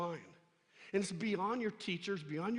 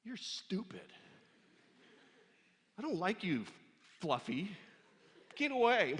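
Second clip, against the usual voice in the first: attitude toward sheep; 4 semitones higher, 3 dB louder than usual. Pretend. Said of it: "having an attitude against the sheep".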